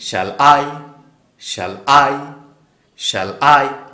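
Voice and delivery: I punched loud after a light "Shall"; light "Shall", hard beat on "I", pause between repeats; stress on I. Shown as a demonstration. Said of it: "এটা কীভাবে পড়বো". (I-তে জোর দিয়ে পড়া)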